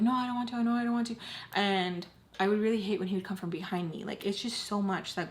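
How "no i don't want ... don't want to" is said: higher, insistent retold protest; freaking out. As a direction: act out a mildly panicked refusal. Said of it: "I would freak out and be like".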